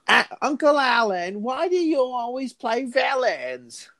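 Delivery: put-on high-pitched, drawn-out child's question; imitated little child. Niece or nephew questioning famous uncle, high child voice. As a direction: pipe up slowly as a little kid asking an uncle. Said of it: "his niece or nephew came up to him and he goes".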